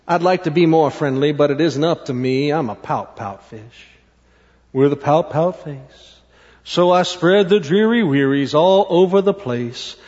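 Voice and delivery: low, resigned sulky fish; glum pout-pout fish. Acted. Glum fish answers jellyfish.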